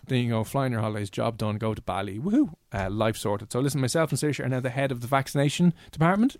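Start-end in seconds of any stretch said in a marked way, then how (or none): 2.2-2.5 high whoop of joy; cheering. Acted. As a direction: cheer it like a holiday. Celebrating holiday trip.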